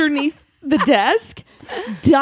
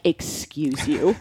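slow, lower, mock offended; affronted; 9 semitones lower, 7 dB quieter, slower than usual. Retelling her reply to the friendship text, mock affronted. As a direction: put on an insulted "excuse you".

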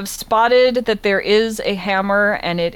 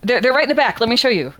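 higher, louder, overly welcoming shopkeep; eager shopkeep; 7 semitones higher, 4 dB louder than usual. Acted. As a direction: usher them in with nervous cheer. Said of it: "And the shopkeep goes".

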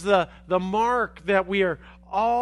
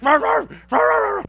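loud, high mock dog barks; barking dog; 12 semitones higher, 6 dB louder than usual. Preacher imitating the angry dog behind the gate, barking. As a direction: bark like a snarling guard dog. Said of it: "he's like".

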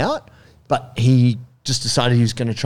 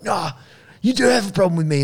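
higher-pitched, challenging street tough; aggressive junkie; 8 semitones higher than usual. Retold or imitated. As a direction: square up to a stranger. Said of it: "he's like".

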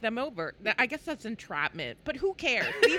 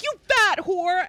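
mock angry shout; 8 semitones higher, 8 dB louder, slower than usual. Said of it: "he was like".

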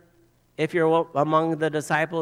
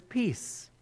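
gentle blessing; 7 dB quieter, slower than usual. Retold or imitated. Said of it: "he says wonderful words".

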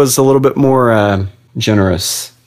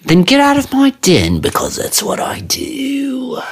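very high, put-on genie voice, slowing at the end; dismissive genie voice; slower than usual. Genie sending a visitor out of the den, mock dismissal. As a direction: shoo them out in a high, drawn-out genie voice.

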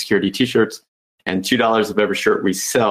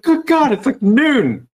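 mock shock; slower than usual. Hotel guest finding it's noon, hammed-up shock. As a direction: play it like the sun just blinded you. Opening the curtains, acted shock.

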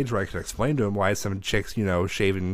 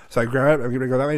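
normal talking voice

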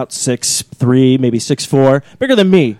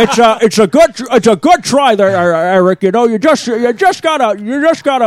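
higher, halting stammer; Jimmy Stewart impression; 7 semitones higher than usual. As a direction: stammer like an old film star. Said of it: "Your Obama turned into Jimmy Stewart".

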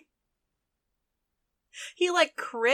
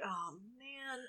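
soft, drawn-out resigned groan of words; hushed in-character "uh-oh" mood; muttered acted dismay; 20 dB quieter than usual. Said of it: "He's like".

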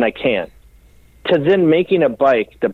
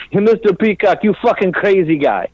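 Dario impression; 7 semitones higher than usual. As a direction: call him out with delighted scorn. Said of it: "he goes".

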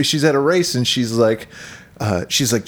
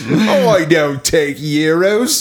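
slow, louder Cockney quip through laughter; mock Cockney laughing; 4 semitones higher, 4 dB louder, much slower than usual. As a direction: laugh while playing the London tart.

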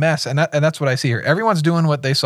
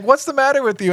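indignant; 12 semitones higher, faster than usual. Retold or imitated. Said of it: "And he's like".

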